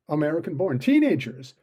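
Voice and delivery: ordinary delivery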